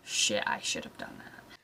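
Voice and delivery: low, breathy whispered regret; regretful whisper. Retold or imitated. Remembering seeing wedding photos, low regretful whisper. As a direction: mutter it to yourself, low. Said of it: "I was like".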